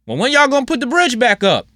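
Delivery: high, whiny mock complaint; mocking a whiny driver demanding the bridge back; mocking clueless commuters. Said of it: "to all of the people who first thoughts is".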